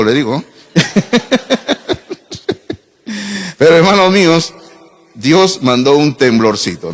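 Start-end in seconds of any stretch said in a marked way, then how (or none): 0.7-2.7 chuckle. Real, not acted. Laughing at his own story.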